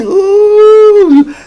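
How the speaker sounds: long, loud falsetto ooh; excited high-pitched whoop. Real, not acted. Hyped reaction to the cards.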